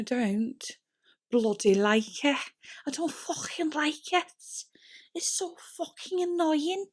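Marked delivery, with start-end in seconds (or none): 2.9-4.2 put-on higher, jokey Scouse accent on "I don't fucking like it". To viewers, annoyed at tangled hair, in a Scouse accent. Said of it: "I don't know why I turned into a scouse". 5.1-6.9 put-on higher, jokey Scouse accent on "I don't fucking like it". To viewers, annoyed at tangled hair, in a Scouse accent. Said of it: "I don't know why I turned into a scouse".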